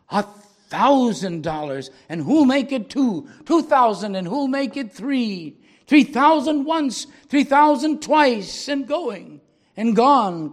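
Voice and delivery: loud, high auctioneer chant, slow and grand on the big sums; auctioneer crying out. Acted. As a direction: sell the violin with rising triumph. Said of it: "cried he".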